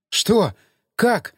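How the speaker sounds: astonished, pitch lifted; amazed. A narrator's reading. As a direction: ask in sheer disbelief. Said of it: "изумилась она".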